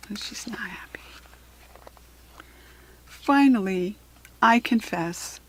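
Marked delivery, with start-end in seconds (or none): 0.1-1.0 whispering. Real, not acted. Reader's aside.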